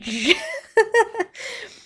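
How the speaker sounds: high burst of giggling; real laughter. Real, not acted. Player amused by own orc impression, high real laugh. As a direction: crack up helplessly.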